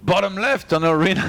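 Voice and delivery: high-pitched mock announcer intro; Funka intro. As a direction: imitate a co-caster's match introduction. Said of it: "I'm gonna do a Funka intro".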